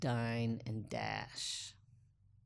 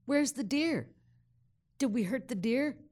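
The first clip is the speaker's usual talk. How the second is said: high, loud, anxious questions; anxious for the deer; 9 semitones higher, 6 dB louder than usual. Retold or imitated. Dazed rider asks her friend about the deer, high and anxious. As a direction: fret loudly over the hurt animal.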